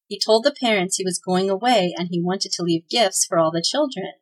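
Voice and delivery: usual speaking voice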